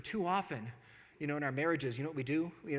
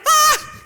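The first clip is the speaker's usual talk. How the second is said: sudden high, loud scream; frightened yelp; 20 dB louder than usual. Retold or imitated. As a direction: yelp as if wanting to hide. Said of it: "Wanting to hide".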